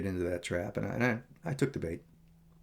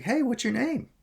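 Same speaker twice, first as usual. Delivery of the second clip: high, louder, overeager greeting; overly nice, awkward; 13 semitones higher, 5 dB louder than usual. Retold or imitated. Stranger in church asking his name, overly nice and awkward. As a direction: imitate an awkwardly over-friendly stranger. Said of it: "overly nice and awkward".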